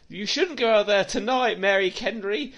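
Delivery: high, pleading hammed-up warning; mock warning. Critic mocking a warning to Mary, high and hammy. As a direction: beg her to stay in, camp and high.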